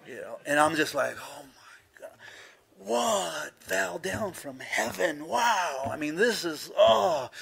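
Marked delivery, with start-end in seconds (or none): 1.2-2.2 stunned, hushed gasp of awe; acting out breathless awe, very soft; awed hush. Boy spots his future wife at the lockers, awed hush. Stunned at first seeing her. 2.8-7.3 high, swooping mock swoon; awestruck. Preacher replays seeing his future wife, awestruck. Acting out love at first sight.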